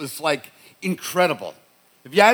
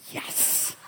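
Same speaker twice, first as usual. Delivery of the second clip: whispered yes; 13 dB quieter than usual. Retold or imitated. Son caught sneaking in stoned.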